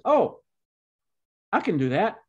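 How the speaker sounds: put-on eager little boy. Child's cheerful offer, retold.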